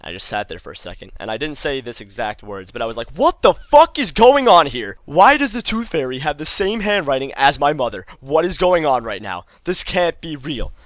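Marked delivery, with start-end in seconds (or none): 3.1-10.7 loud, incredulous retold outrage; indignant ranting, easing off toward the end; raging. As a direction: act out a furious discovery, starting high and loud. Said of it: "I guess, raging".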